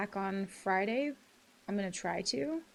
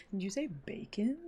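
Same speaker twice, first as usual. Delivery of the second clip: hushed whispered question; breathy whisper, half to herself. Real, not acted. Whispered aside while gaming.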